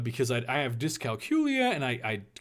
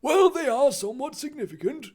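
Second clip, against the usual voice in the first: raised, put-on headmaster voice; tentative Dumbledore; 13 semitones higher, 6 dB louder than usual. Dumbledore answering Harry about divisions, tentatively. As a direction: concede it in a high character voice. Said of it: "Dumbledore allowed tentatively".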